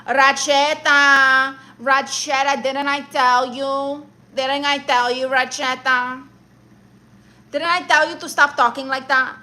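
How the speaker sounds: imitating a New York aunt with a mafia accent; drawn-out New York mafia accent, scolding. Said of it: "they all sound like they're in the mafia".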